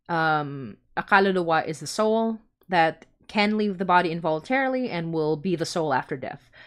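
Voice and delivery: ordinary talk, nothing marked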